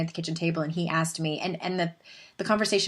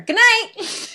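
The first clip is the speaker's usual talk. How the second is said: high, loud mock-cheery good night; hasty sign-off; 6 dB louder than usual. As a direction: reenact cutting the talk off with a sing-out goodbye. Abruptly ends awkward bedtime talk.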